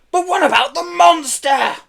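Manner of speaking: high, loud, drawn-out screech; furious screech. A narrator's reading. Pelly as the police cars leave, screeching. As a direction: screech after them in fury. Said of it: "leaving Pelly screeching them in fury".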